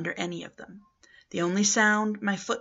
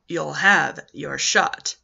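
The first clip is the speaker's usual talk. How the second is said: slow, louder orderly voice, insisting; menacing orderly; 4 semitones lower, 6 dB louder, much slower than usual. Acted. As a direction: drag it out as a threat, lightly voiced. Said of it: "he said".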